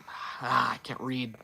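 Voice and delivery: normal talking voice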